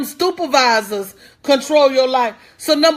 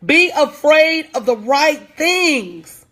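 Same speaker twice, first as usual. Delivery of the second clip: slow preaching refrain; slower than usual. Real, not acted. Repeated refrain, slower.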